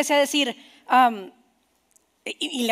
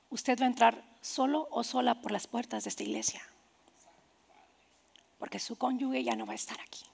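very soft, low, near-whisper; grave and hushed, with long pauses; hushed solemnity; 3 semitones lower, 11 dB quieter than usual. Real, not acted. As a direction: paint the loss gently, almost whispering. Asks church to imagine widowhood.

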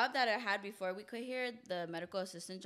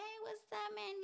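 friendly greeting; 13 semitones higher, 6 dB quieter than usual. Retold or imitated. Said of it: "I'm like".

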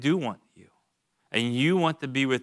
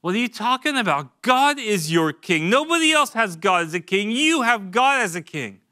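higher, indignant, raised protest; offended Samuel; 6 semitones higher, 3 dB louder than usual. Acted. Samuel rebuking the people for wanting a king, indignant. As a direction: push back hard, stunned they'd reject God. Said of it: "Samuel was offended".

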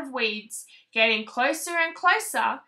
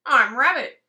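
louder rabbit character; 9 dB louder than usual. Acted. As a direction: bold rabbit intro. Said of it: "said the twitchy-nosed creature".

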